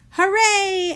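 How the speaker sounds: high loud cheer. A narrator's reading. The family cheers the hiccups are gone, high loud cheer. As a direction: cheer with joy. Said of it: "everyone cheered".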